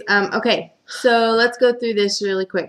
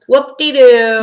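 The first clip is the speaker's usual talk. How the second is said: sarcastic whoop-de-doo; 5 semitones higher, 13 dB louder, slower than usual. Real, not acted. Sarcastic dismissal of plot.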